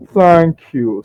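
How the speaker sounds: high, loud thanks; merchant calling out. Acted. Merchant thanks orcs before shutting door, high showy call. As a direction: sing it out to those outside.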